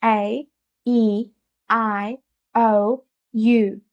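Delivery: vowels said with mouth wide open; open-mouthed, over-articulated vowel drill; exaggerated vowels. Shown as a demonstration. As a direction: stretch each vowel, one at a time. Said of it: "मुंह के full potential को खोलकर".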